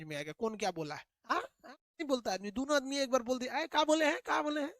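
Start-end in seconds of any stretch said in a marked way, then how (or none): 3.5-4.7 puzzled asking. Acted. Confused listener hearing two voices.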